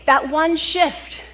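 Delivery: slow and deliberate on one; stressing that one shift; slow emphasis. Acted. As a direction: lean into each word slowly.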